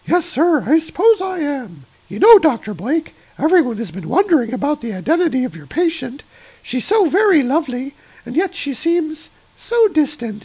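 put-on high, breathy voice of a young nurse. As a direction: lift into a high, prim nurse voice. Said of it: "the nurse is kinda".

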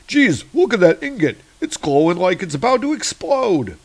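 high, excited working-man voice; alarmed steel worker. Acted. Steel worker warning others about the glowing ingot, high and alarmed. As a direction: blurt it out in high-pitched alarm.

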